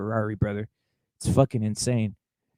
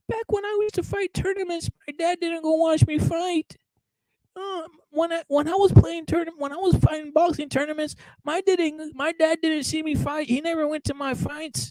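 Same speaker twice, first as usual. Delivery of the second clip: much higher, whiny mock voice of a spoiled boxer; mocking whiny crybaby; 14 semitones higher than usual. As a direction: sneer by whining like a pampered kid.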